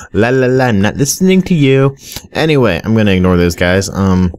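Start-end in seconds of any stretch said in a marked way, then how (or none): 0.1-2.0 put-on childish la la la. Mocking ignoring.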